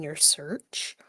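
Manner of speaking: soft unvoiced whisper. Real, not acted. Host trailing off into a whisper. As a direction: breathe the words out. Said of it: "trailing whisper".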